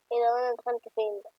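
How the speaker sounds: usual speaking voice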